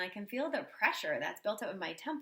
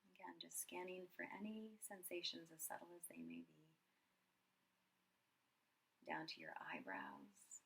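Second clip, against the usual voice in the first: soft, unhurried meditation voice with long pauses; guided meditation; 7 dB quieter than usual. Acted. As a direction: guide them gently through a body scan, quietly. Leads a body scan meditation.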